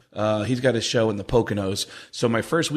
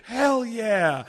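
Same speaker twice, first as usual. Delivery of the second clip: high, amused laugh-talk; imitating Mitch laughing; 9 semitones higher, much slower than usual. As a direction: laugh along at the stoned guy. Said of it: "start laughing at Thomas and go".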